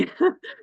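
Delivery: a quick real laugh at her own joke; short laugh. Real, not acted. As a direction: chuckle at yourself, brightly.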